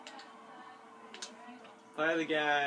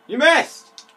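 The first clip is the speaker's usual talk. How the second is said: high, very loud gloating shout; excited taunt; 11 semitones higher, 15 dB louder than usual. Real, not acted. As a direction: shout it at the opponent. Taunts opponent mid-game.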